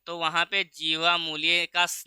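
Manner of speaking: usual speaking voice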